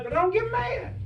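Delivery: high, insistent punchline denial. Real, not acted. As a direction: land the denial high and firm. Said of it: "I never think I get mad".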